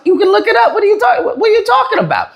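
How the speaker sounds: incredulous, squeaky-high pushback; exasperated high retort. Retold or imitated. Heated argument, quoting himself.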